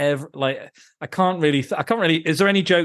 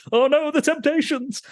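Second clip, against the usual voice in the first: much higher, alarmed cry; mock dismay. Host quoting the character spotting the Temptations, mock alarmed. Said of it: "he's like".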